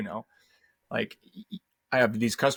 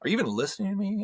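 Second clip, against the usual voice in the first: mock exasperated question; 9 semitones higher, faster than usual. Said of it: "turns into a".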